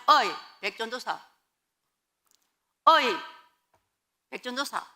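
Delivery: brisk, casual "hey you" call; offhand summons. Retold or imitated. Church calling the demoted new evangelist, curt and casual. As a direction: call a junior over without ceremony. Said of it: "이렇게 부르는 거예요".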